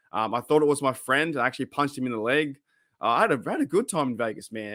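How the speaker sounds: ordinary talk, nothing marked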